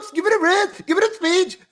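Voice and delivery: high mock pleading with the crowd; mocking imitation. Mimics the clip speaker again.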